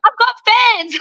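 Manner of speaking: high, gleeful repeat of the news; delighted high-pitched echo; thrilled. Retold or imitated. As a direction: squeal it like a prize. Excited about ceiling fans.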